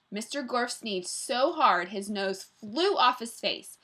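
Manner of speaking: plain reading voice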